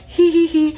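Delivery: high, stifled mock giggle; imitated giggling. Couple trying not to draw attention, imitated giggle. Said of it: "starts giggling".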